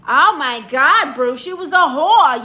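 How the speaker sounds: loud, scandalized reaction; shocked and emphatic, voice jumping up; appalled outburst. Real, not acted. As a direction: blurt it out in loud disbelief. Appalled by viewer's cheating ex story.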